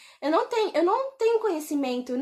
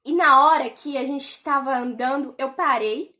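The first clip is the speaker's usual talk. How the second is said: normal talking voice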